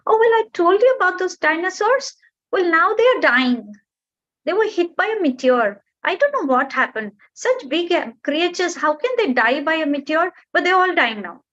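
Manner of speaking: high, wide-eyed storytelling bird; eager little bird. Acted. Bird amazing the mountain with tales of dinosaurs, high and eager. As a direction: marvel at the huge creatures.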